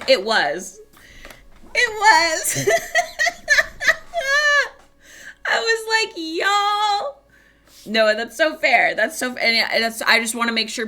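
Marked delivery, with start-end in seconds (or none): 0.0-7.2 high, loud, near-tears laughter; hysterical laughter. Real, not acted. Retelling the clinic mix-up to the audience, laughing hysterically. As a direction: lose it completely, laughing till crying.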